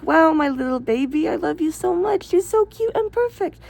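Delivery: put-on high cooing baby talk to a pet. Shown as a demonstration. Owner talking to her dog, high cooing baby talk. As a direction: coo it high like to a puppy.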